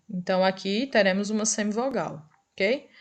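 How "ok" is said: usual speaking voice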